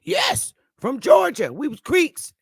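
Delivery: high, emphatic "Yes" and stressed "Creeks"; mimicking an elder stressing "Creeks"; proud emphasis. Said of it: "they really put emphasis".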